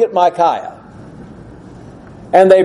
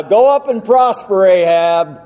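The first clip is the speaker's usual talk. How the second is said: mock cheering-on, pitched up then dropping on the name; sarcastic encouragement. Shown as a demonstration. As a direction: send him off with obviously insincere agreement. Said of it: "the tone that Micaiah used".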